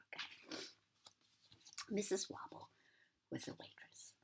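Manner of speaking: usual speaking voice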